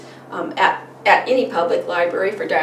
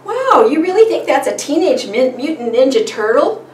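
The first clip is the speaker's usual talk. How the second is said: put-on higher, louder impressed reaction to a child; amazed parent prompt; 6 semitones higher, 5 dB louder than usual. Shown as a demonstration. Parent answering a preschooler's guess, amazed. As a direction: play up the wonder for a small child. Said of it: "And then you can say".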